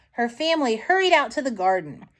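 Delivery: plain reading voice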